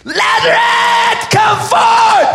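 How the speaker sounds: slow shout rising very high; drawn-out, full-force command; commanding Jesus. Acted. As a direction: roar it out to raise the dead. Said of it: "but I also see him like".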